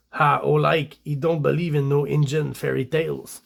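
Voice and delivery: broad put-on French Canadian English; French Canadian accent. Reading the French Canadian's comic line to viewers, French Canadian accent. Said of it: "here's the French Canadian again".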